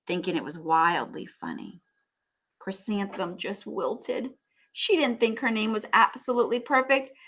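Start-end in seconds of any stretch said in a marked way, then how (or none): none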